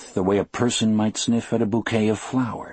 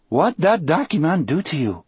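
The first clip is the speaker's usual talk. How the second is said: put-on Cajun accent; 9 semitones higher, 3 dB louder than usual. A narrator's reading. Delacroix frightened Cajun voice.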